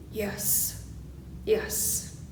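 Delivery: breathy whispered "Yes, yes". Real, not acted. Minister whispering agreement to the congregation. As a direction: hush it out, a private aside. Minister's aside after laughter quote.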